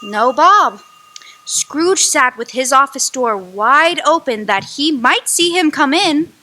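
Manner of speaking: ordinary delivery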